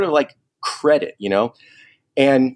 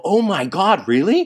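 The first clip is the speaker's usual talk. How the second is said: higher, eager oh my God, really; thrilled disbelief; 7 semitones higher than usual. Retold or imitated. Fan hears his favourite band will attend, thrilled disbelief. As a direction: jump up at the news. Learns [name] will be there.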